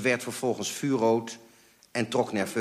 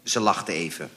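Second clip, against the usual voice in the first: plain reading voice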